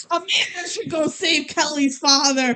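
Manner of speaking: high, wobbly with laughter; laughing mock plea. Real, not acted. Co-host joking that Amanda should rescue the father, laughing. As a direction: squeeze the words out through giggles.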